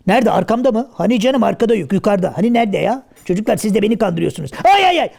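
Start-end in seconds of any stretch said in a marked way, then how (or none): 4.7-5.1 high yelping ay ay ay; pained yelps. Acted. Karagöz yelps high at a sharp sting. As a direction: yelp high like something stung you.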